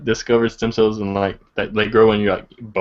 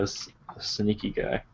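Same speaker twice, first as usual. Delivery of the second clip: sly hush; 12 dB quieter than usual. Real, not acted. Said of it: "Sneaky, sneaky".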